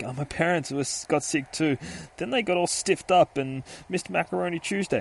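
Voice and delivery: put-on high, innocent kid voice; little boy voice. Boy telling detective about his sick parents, child voice. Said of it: "And he's like".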